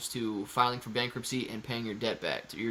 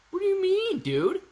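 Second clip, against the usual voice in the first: incredulous. Real, not acted. Streamer reacting to a viewer's doubt, incredulous high pitch. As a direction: jump way up high on what do you mean.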